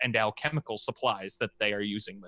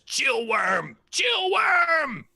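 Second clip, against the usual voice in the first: very high, loud creature shout; dragon voice; 12 semitones higher, 4 dB louder, much slower than usual. Acted. Dragon names itself to the party, high loud shout. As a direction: bellow the name up high like a beast. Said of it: "the dragon looks at you and goes".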